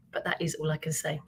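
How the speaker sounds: ordinary talk, nothing marked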